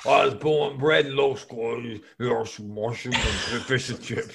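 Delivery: garbled, hammed-up British; British accent. British peasant boasting of his upbringing, garbled British accent. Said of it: "this British accent".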